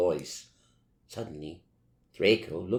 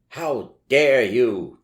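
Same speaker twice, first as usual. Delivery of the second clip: slow, louder indignant demand; 5 semitones higher, 8 dB louder, much slower than usual. A narrator's reading. Snape scolding the students, slow indignant demand. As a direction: drag it out, outraged. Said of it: "demanded Professor Snape".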